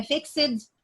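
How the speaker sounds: "fixed" said as "fix-id", with an extra syllable; teacher sounds out "fixed" the wrong way, "fix-id"; wrong pronunciation. Shown as a demonstration. Teacher warning students off the wrong "fix-id". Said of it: "No, this is not English".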